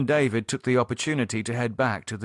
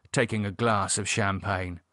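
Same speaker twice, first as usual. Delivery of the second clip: usual speaking voice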